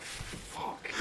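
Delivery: barely voiced private curse; whispered inner thought. Comic retelling his secret reaction while the crowd laughs, whispered inner thought. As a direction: whisper it to yourself. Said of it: "like, deep down, I'm like".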